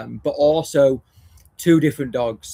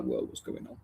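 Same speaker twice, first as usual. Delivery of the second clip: much lower, whispered whoa; hushed, low watchful whoa; wary dog voice; 13 semitones lower, 13 dB quieter than usual. Retold or imitated. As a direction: voice a dog spotting something odd. Voicing alert German Shepherd.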